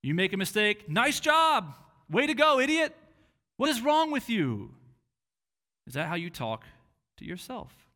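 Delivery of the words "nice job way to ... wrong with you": loud, high mocking inner voice; harsh sarcastic jeer; sarcastic self-scolding. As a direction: sneer at yourself for a mistake. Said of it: "condescending, demeaning, mocking, or sarcastic in tone".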